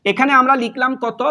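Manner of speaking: ordinary talk, nothing marked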